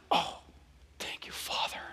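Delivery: very soft, whispered prayer; acted intimate murmur to the Father; hushed gratitude. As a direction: whisper it in awed thanks. Acted hushed reaction of Jesus.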